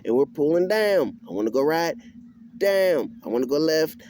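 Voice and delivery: ordinary talk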